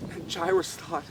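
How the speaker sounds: usual speaking voice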